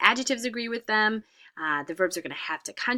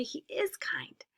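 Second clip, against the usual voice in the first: whispered, slow correct form; soft correct form; 7 semitones higher, 7 dB quieter, slower than usual. Shown as a demonstration. Teacher whispering the correct sentence to students. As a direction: breathe out the right answer gently. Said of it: "We have to say".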